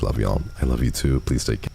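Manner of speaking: ordinary talk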